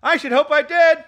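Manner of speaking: high, loud lad; boy's voice. Acted. Lad answering Scrooge from the street, high boyish voice. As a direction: pipe up as a boy. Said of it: "replied the lad".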